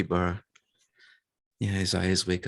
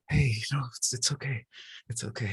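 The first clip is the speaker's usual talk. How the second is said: higher, softening reassurance; tender comforting; 6 semitones higher, 3 dB quieter, faster than usual. Acted. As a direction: gently soothe a crying child, voice dropping softer. Capybara comforts his crying daughter.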